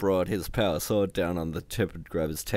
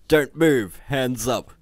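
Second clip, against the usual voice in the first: loud, higher-pitched order; commanding shout; 4 semitones higher, 7 dB louder, slower than usual. A narrator's reading. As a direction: command the whole room to freeze. Dread lord orders the fighters.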